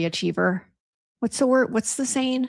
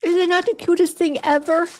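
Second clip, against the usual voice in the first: gushing; 8 semitones higher than usual. Real, not acted. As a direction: gush over something adorable. Showing off the dog toy.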